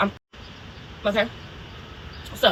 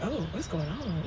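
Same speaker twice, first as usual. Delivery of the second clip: much softer, slow worried question; uneasy murmur; 3 semitones lower, 14 dB quieter, slower than usual. Retold or imitated. Waking up uneasy, she murmurs softly to herself. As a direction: hush it like you're scared in bed. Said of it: "I was like".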